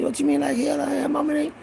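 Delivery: pitched high, pleading innocence; mock-innocent high plea; high protest. Boy protests to mama about the ring, pitched high. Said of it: "retold protest to mama".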